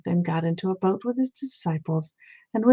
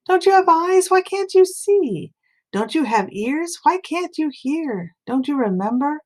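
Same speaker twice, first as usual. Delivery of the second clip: much higher, pleading and exasperated; exasperated rebuke; 8 semitones higher than usual. A narrator's reading. Jesus scolds disciples who cannot see, exasperated high-pitched rebuke. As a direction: cry out at them in disbelief, slowing down.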